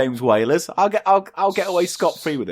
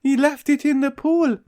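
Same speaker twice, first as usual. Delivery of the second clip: high, silly put-on duck voice; Dinky the Duck; 12 semitones higher than usual. Duck explaining himself to the whale, silly high voice. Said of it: "So Dinky goes".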